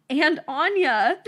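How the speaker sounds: slow, loud words collapsing into laughter; can't get through it for giggling; laughing. Real, not acted. Reading the old note to a friend, bursting out laughing.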